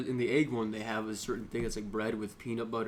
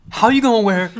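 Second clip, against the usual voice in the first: loud, high burst of disbelief; outraged rant; 9 semitones higher, 16 dB louder than usual. Real, not acted. Podcaster incredulous at two-team Rams fan, outraged shout. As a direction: cry it out in comic outrage.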